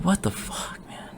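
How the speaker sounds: breathy whispered aside; hushed stunned whisper. Real, not acted. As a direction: whisper it in disbelief. Exasperated aside after emotional outburst.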